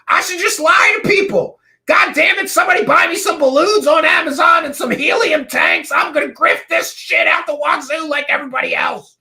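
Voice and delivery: high, loud shouting tirade; exasperated mock rant. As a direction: blow up in hammed-up frustration. Mock rant about turning grifter.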